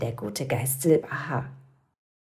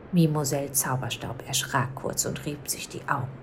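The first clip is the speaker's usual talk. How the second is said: ordinary delivery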